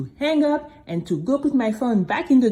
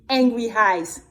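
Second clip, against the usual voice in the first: plain reading voice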